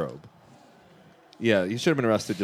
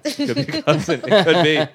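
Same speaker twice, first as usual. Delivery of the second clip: laughing; 6 semitones higher, 5 dB louder than usual. Real, not acted. Laughing at cousin lap dance joke.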